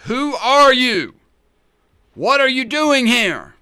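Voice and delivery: high, loud, slow bellow of a guard; soldier's shout. Acted. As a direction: roar it out like a Roman sentry. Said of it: "startled by this shout".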